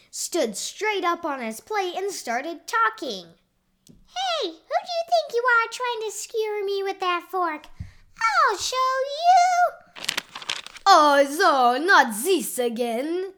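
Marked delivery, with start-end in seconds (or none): 3.9-10.1 very high, squeaky cartoon threat; angry little noodle. A narrator's reading. Cavatappi threatening the diner with a fork, squeaky outrage. 10.8-13.3 put-on loud, drawn-out Italian-accented cry; yelling Mushroom. A narrator's reading. Waiter seeing the noodle act up, exasperated yell. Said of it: "yelled Mushroom".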